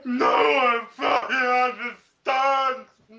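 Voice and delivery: wailing shout, slow and drawn out; melodramatic wail. Acted. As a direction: wail it like a misunderstood goth teen. Dramatic reading of angsty fanfic.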